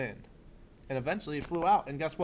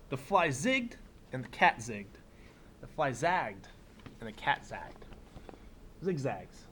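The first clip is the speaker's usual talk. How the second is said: put-on reading to children; 4 dB quieter than usual. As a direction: read it like story time for a class. Reads picture book aloud.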